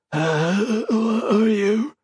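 high, slow and rasping, near tears. Acted. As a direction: drag it out weakly through a raw throat. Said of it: "she rasped".

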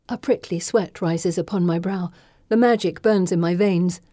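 ordinary delivery, nothing marked

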